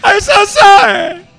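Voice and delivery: loud, high, stretched-out wailed apology; hammed-up sobbing man; mock crying. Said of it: "tears are just gushing".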